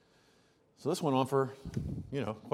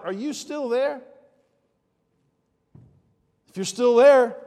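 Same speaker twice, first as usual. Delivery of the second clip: loud, higher call to the listener; 8 semitones higher, 11 dB louder, slower than usual. Real, not acted. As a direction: reach out loudly to whoever listens. Preacher calls out to listener.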